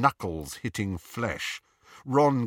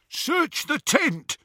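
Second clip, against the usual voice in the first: high, drawn-out call; barked order; slower than usual. A narrator's reading. Captor to gang at the tent, high called-out order.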